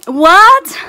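high, loud rising exclamation; stunned, shouted question; shocked disbelief. Real, not acted. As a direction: burst out in amazed disbelief. Shocked by what the package holds.

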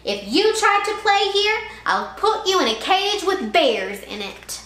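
high, loud mock threat; bully threatening. A narrator's reading. Sammy threatens Kevin, loud bully voice. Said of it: "Sammy threatens Kevin".

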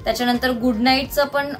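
ordinary talk

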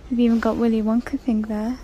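usual speaking voice

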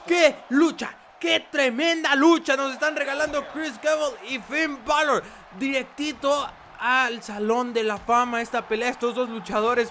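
loud, fast play-by-play commentary; wrestling commentator. Acted. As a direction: call the match with rising hype.

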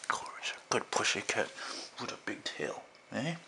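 soft whispered praise for a pet; hushed, doting whisper; whisper to the cat. Real, not acted. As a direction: whisper sweetly to the kitty. Praising his cat softly.

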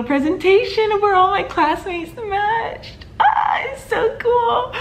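tearful excitement. Real, not acted. Watching classmates' matches, crying out with delight. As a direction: overcome with joy for classmates.

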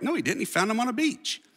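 high-pitched skeptical heckle; mock objection. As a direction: play the know-it-all listener protesting. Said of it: "You're like".

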